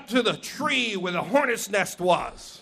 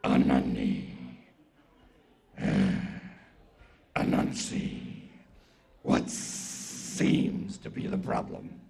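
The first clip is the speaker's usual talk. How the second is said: very low, slow, whispery snake; drawn-out, sibilant deep rasp; great python; 6 dB quieter, slower than usual. Acted. Python asks Anansi his problem, slow deep rasp.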